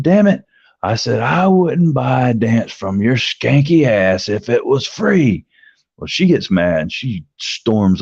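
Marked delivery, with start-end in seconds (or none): 0.0-0.4 sudden high, loud retold curse; irritated outburst. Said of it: "by then, I'm fed up". 1.2-5.4 high, slow, scornful put-down climbing on the last word; irritated retort. Retold or imitated. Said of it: "I'm irritated at her".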